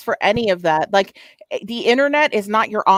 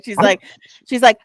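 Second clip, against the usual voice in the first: ordinary talk, nothing marked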